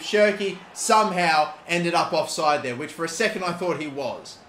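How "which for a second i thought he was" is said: ordinary talk, nothing marked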